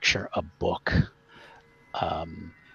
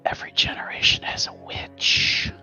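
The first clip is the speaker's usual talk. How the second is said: unvoiced old witch whisper; frail shaky old whisper; 3 dB louder than usual. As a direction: breathe it like a dead grandmother. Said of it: "great grandma's voice says".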